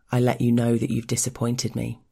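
ordinary delivery, nothing marked

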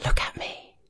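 unvoiced intimate whisper; tender whispered command. A narrator's reading. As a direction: breathe it right against his lips. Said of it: "and whispers".

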